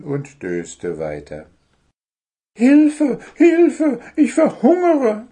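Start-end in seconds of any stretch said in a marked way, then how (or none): 2.6-5.3 very high, loud squawked cries; squawking little bird. A narrator's reading. Hungry bird yells for help, squawking shrilly. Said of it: "krakeelte Zwitschi so laut er konnte".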